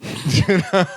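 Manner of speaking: laughing through the words. Real, not acted. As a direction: chuckle it out. Laughing aside.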